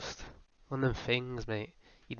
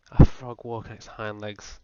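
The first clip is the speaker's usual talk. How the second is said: disbelief; 9 dB louder than usual. Acted. Incredulous rhetorical question.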